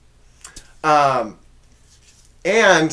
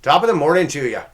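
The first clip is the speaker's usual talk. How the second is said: loud, raised-pitch Irish accent drifting British-Australian; botched Irish accent; 5 semitones higher, 9 dB louder, faster than usual. Shown as a demonstration. Host shows off a poor accent, botched Irish. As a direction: attempt Irish, land somewhere British-Australian. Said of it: "I always sound like I'm a British Australian guy".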